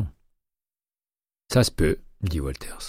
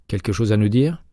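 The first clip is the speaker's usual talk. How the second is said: louder, higher goading challenge; challenging; 4 semitones higher, 4 dB louder than usual. A narrator's reading. Arango daring the ex-FBI expert to help, louder and goading. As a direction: toss it at him like a dare, louder. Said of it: "Le défi s'entendait haut et fort".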